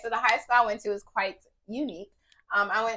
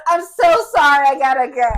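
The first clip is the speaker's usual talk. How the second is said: loud, higher mock apology; hammed-up apology; 4 semitones higher, 12 dB louder than usual. Student excusing herself to hide laughter, loud hammed apology. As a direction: overdo the sorry as you rush out. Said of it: "I'm like".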